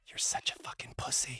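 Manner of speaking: unvoiced whisper, leaned in close; teasing whisper. Ex-wife teasing him at the wedding, in a whisper. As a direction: hiss it as a fond insult. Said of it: "leans over and goes".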